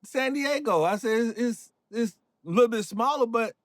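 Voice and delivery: high-pitched, mildly defensive insistence; retold protest. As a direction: act out setting the teasers straight about your hometown. Defends hometown against teasing questions.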